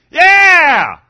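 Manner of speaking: sudden high, full-throated shout; huge celebratory yell; yelled cheer. Retold or imitated. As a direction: explode like a fan seeing a touchdown. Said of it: "I mean, they yelled".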